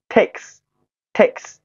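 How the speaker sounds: "takes" slowly, ending in a hissed S; final voiceless S. Shown as a demonstration. Teacher models the word for learners, slow "takes" ending in S. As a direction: sound out "takes" with crisp unvoiced S after K. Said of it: "所以说色色要读作S".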